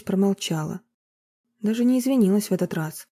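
ordinary delivery

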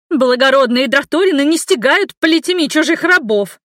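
high, loud indignant retort; shrill retort. A narrator's reading. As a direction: fire back at him, high and loud. Servant shouts back at guard.

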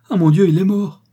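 high, loud wail of shock; horrified cry. A narrator's reading. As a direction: exclaim in horror. Worker believes his master is dead.

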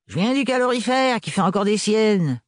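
sullen voice. A narrator's reading. Gaëlle to Renard, sullen mechanic's voice. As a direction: grumble it like a tired mechanic. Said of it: "d'une voix maussade".

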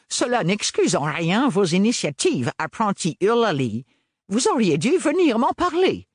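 cold haughty rebuke, rising; haughty stern teacher. A narrator's reading. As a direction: scold her from on high. Lady Septima rebukes. (professeure hautaine et sévère)

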